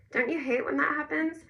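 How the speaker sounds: high, squeaky teasing voice. A narrator's reading. Narrator mimicking Ezra's voice crack in sympathy, high and squeaky. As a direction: pitch it up into a mock squeak. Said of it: "I made my voice go high and squeaky".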